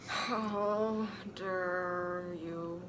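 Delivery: soft, very slow, menacing demand; Snape demanding. Acted. Snape confronting the pair, slow soft menace. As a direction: drag it out, low and cold. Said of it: "demanded Professor Snape".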